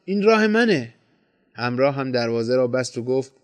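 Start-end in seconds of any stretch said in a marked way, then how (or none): 0.1-0.9 high-pitched exclamation; crying out. A narrator's reading. Narrator recognizes his own road and cries out, high. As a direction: call it out in raised pitch. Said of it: "داد زدم".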